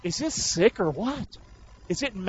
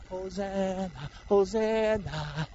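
mock hosanna; 8 dB quieter, slower than usual. Mocking the empty cheer.

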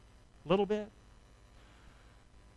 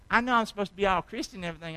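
ordinary talk, nothing marked

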